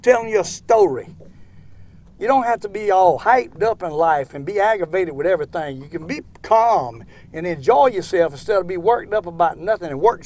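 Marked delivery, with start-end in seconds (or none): none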